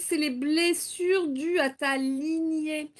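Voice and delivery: normal talking voice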